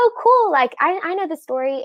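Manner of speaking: high-pitched, breezy "oh, cool"; breezy recalled reaction. Retold or imitated. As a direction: toss it off brightly, quoting your younger self. Quoting her earlier casual reaction.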